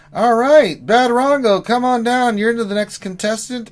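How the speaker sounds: loud, high host announcement; game-show host. Acted. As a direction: call the next contestant up. Game-show style call.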